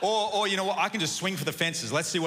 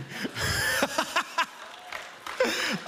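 airy, high-pitched giggle; real laughter, mostly air; high breathless laugh; 10 semitones higher than usual. Real, not acted. Laughing at his friend Sean.

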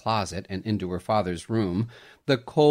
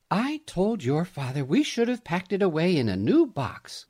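mother voice; 8 semitones higher than usual. A narrator's reading. Mother's voice in story.

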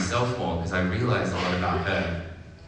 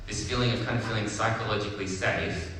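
ordinary delivery

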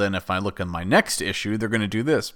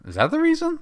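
much higher, mock disbelief; incredulous; faster than usual. Real, not acted. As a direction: squeak it out in disbelief. Incredulous question in rant.

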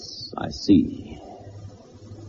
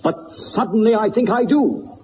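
calling Watson off in a burst of eager energy; sudden excitement; 10 semitones higher than usual. Acted. Holmes suddenly grasps the answer and rushes Watson out, excited and high. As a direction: pitch jumps up high as the idea strikes.

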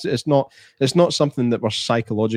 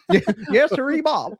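much higher put-on American accent, laughing through it; laughing mock American accent; 12 semitones higher than usual. Cracking up while playing an American yokel, higher.

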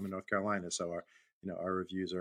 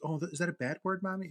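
put-on higher, childlike question; innocent little boy; 9 semitones higher than usual. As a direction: imitate a kid asking Mommy. Small boy voiced in story.

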